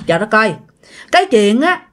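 ordinary delivery

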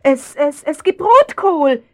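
high, loud fake-cheery voice; forced cheerfulness. Mother covering up the kiss with false cheer. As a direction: distract the child with false brightness.

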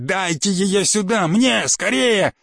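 slow, stretched, eager pleading; joyful impatience. Acted. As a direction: beg for it with overwhelming delight. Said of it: "в голосе его звучала большая человеческая радость".

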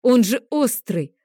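mother snarling at the dragon that the sword is sharp, slow and loud; angry growl. A narrator's reading. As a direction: snarl the warning at him. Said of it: "рыкнула я на дракона".

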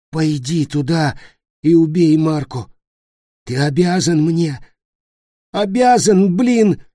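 high, loud, ending in a shout; furious demand. Acted. Dying Nick furiously demanding Peter kill Marko. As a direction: demand it with your last breath.

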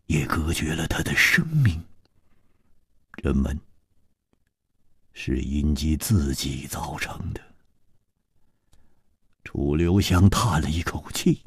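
hushed, sorrowful storyteller dropping to a whisper; story narration. A narrator's reading.

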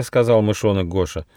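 usual speaking voice

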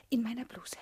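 unvoiced secret whisper. Wife telling husband where she hid the gun, whispered. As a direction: breathe it conspiratorially.

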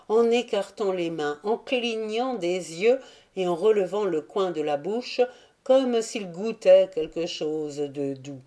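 plain reading voice

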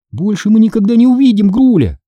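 high, confident promise; assuring. A narrator's reading. Sister promising brother the monster is gone, assuring. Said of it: "заверила я".

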